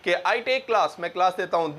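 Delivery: ordinary delivery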